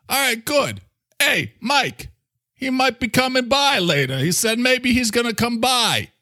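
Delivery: high New York character calling to a pal; brash New Yorker, passing on news; Mister Coffee. Acted. New Yorker telling the microphone about a visitor, high and brash.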